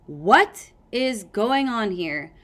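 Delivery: slow, loud, booming demand. A narrator's reading. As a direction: halt the room with a booming question. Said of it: "Kestrel's booming voice".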